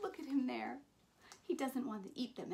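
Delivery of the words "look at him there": ordinary delivery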